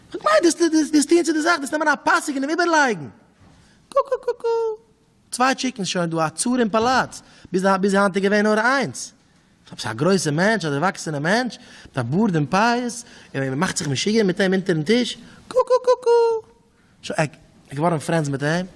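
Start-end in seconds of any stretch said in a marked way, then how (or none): 0.1-3.1 high character voice. Acted. Chicken prince answers the king. 3.9-4.8 high clucking imitation of a chicken. A storyteller clucks like the prince who thinks he is a chicken, high and comic. 15.5-16.6 high clucking imitation of a chicken. A storyteller clucks like the prince who thinks he is a chicken, high and comic.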